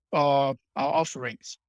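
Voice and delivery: normal talking voice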